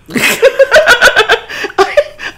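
long, high-pitched laughing fit; delighted laughter. Real, not acted. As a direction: burst out laughing at a silly idea. Reacts to the slap chop joke.

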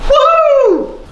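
loud high whoop; high cheer. Acted. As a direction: whoop with delight.

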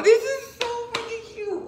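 excited squeal. Real, not acted. Squealing to viewers at the cute new piece. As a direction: shriek it out thrilled.

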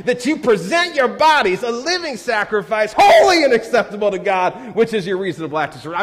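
high and loud, building to a laughing shout; mock fiery Southern preaching, half laughing; fiery young preacher. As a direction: preach it like a fired-up Southern college kid. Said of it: "I was into it".

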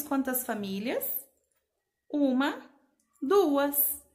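ordinary talk

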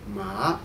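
"ma" from low gliding up; rising second tone. Shown as a demonstration. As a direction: start "ma" low and raise it. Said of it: "in order, we say".

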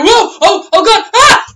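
high, loud cries of alarm while slipping; slipping off the catapult, yelling in panic; panicked yelps. Retold or imitated. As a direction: scream as you lose your grip. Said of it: "And you".

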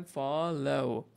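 ফলো stretched very slowly and clearly; slow model pronunciation. Shown as a demonstration. Teacher showing students how to say ফলো, very slow. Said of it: "pronunciation খেয়াল করেন".

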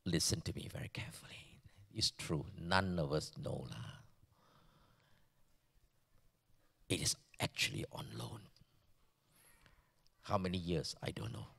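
fast, hushed whisper; urgent secret, close to the ear. Real, not acted. Preacher warning his congregation, urgent whisper.

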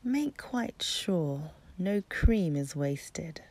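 ordinary delivery, nothing marked

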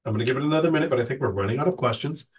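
stuffy nose from a cold; talking through a blocked nose; nasal voice. Real, not acted. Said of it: "my nasally voice from getting over my cold".

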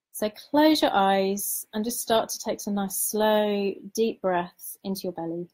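guided meditation. Acted. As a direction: lead them gently into calm, unhurried.

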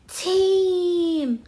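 high, loud family cheer; joyful exclamation. A narrator's reading. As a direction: call out the name in delight. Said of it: "exclaimed Jim's family".

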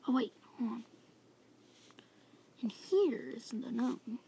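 whispering. Real, not acted. Sneaking in a horror game.

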